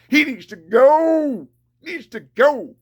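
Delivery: he needs to go, hammered out slowly and loud; each word dropped like a verdict; slow furious insistence. Real, not acted. Demanding the extortionist be driven out, slow and furious. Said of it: "That's how angry he is at this man".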